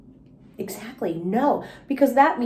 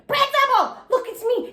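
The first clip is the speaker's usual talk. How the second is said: high, eager call to the principal; excited calling out; 14 semitones higher than usual. Acted. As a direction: call him over, thrilled to see him. Junie calls out excitedly.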